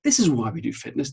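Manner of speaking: normal talking voice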